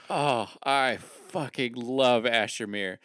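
ordinary talk

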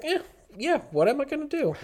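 high-pitched retold shrug. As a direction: brush it off in a high voice. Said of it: "Sometimes you just don't wanna do anything".

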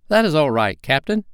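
high-pitched, self-satisfied sidekick; pleased with himself, high voice; complacent. A narrator's reading. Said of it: "Smee answered complacently".

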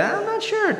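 high, light unsure tone; unsure skeptic. Acted. Preacher contrasting skeptic with cynic, high and unsure. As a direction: float it up, uncertain. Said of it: "You hear the".

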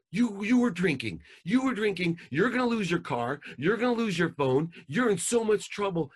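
high, loud furious yell; angry yelling dad. Retold or imitated. Dad yells at his missing daughter, high and loud. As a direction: bark it at her like a furious father. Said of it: "imagine if I would have called".

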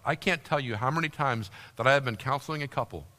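ordinary delivery

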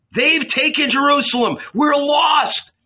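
high, loud desperate cry; panicked shout. A narrator's reading. Fleeing crowd crying out in despair. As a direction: wail it out as the city falls. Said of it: "they shouted".

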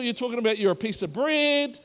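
ordinary talk, nothing marked

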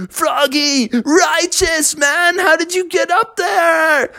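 high, slow, laid-back call; a chill beaver shouting up, amazed; surfer-dude beaver. Acted. Bucky calls up to Froggy.

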